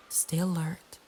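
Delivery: usual speaking voice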